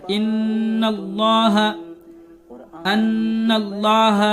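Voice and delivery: ان اللہ very slow, the نّ held long and nasal; held nasal ghunna. Shown as a demonstration. Teacher reciting ان اللہ to show ghunna, slowly. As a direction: stretch the doubled ن in ان with ghunna. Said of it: "وہاں غنہ کر کے پڑھتے ہیں".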